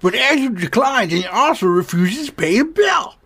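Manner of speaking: a hint of Adam Sandler stand-up. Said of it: "I know that you're Adam Sandler".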